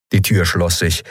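ordinary delivery, nothing marked

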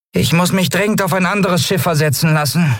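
high piping disbelief; squeaky. A narrator's reading. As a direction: pipe it up like a tiny creature. Nelen complains.

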